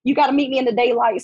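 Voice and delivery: ordinary talk, nothing marked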